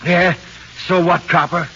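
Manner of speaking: loud, high, sneering retort; defiant shout. Acted. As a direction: snap back at the copper, loud and cocky. Suspect defies cop in interrogation.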